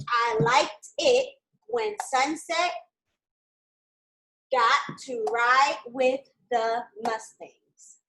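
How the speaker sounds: "I liked it when Sunset got to ride with the Mustangs" said very slowly, word by word; slow dictation. Shown as a demonstration. Teacher dictating the sentence to students, very slow. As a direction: dictate it slowly so they can write. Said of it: "The sentence is supposed to say".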